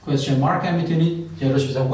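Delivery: ordinary talk, nothing marked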